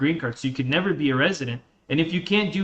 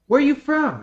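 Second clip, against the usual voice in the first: higher-pitched imitation of a nosy stranger; curious questioner; 8 semitones higher, 4 dB louder than usual. Strangers asking where the speaker is from, mock curiosity. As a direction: ask it high, as a mock stranger.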